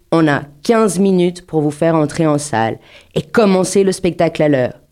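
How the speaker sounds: ordinary talk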